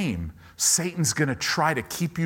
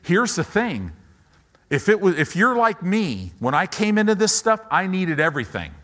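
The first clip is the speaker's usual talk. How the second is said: higher, louder pulpit preaching; full-force preaching; 5 semitones higher, 4 dB louder than usual. Acted. Pastor urging the congregation to trust God, at full force. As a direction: preach with rising fire.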